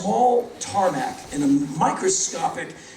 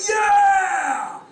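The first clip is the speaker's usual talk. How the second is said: higher, louder cheering yell; crowd cheer; 13 semitones higher, 4 dB louder than usual. Retold or imitated. Audience cheering [name] again, loud high yell. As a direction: roar it like the whole room.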